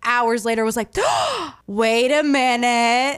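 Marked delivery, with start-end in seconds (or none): 1.0-1.6 sharp mock gasp; hammed-up sudden intake of breath; gasp of realization. Daughter acting out mom's late epiphany, mock gasp. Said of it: "an epiphany". 1.7-3.2 slow, stretched-out dawning realization; mock epiphany. Daughter imitating mom remembering the bottle, slow mock epiphany. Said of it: "an epiphany".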